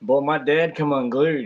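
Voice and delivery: ordinary delivery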